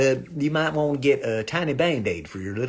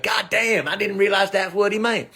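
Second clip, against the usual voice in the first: higher-pitched exclaimed realization; mock realization; 7 semitones higher than usual. Listener getting the joke on the way home, mock realization. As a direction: exclaim as if it just dawned on you.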